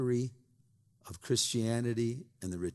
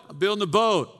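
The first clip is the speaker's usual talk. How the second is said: high, loud earnest reply; voiced Noah, raised and insistent; Noah answering; 11 semitones higher, 11 dB louder, faster than usual. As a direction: patiently answer the mockers.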